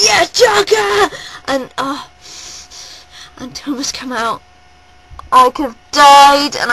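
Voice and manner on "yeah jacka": high, loud, slow drawn-out cheer to the drummer; screaming the drummer's name back; fan cheer. Retold or imitated. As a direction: yell his name like a fan at the stage.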